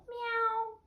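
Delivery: high little meow; cat's meow. A narrator's reading. Cats protesting the theft, high meow. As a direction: mew high like a cat. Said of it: "began to meow".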